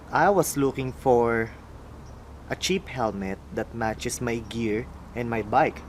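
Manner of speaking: usual speaking voice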